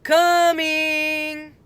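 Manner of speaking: high, loud shout out the window; shouted reply. A narrator's reading. Annie shouting out the window to mom, loud call. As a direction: yell back to mom. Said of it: "and shouted".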